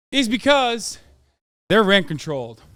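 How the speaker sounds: ordinary talk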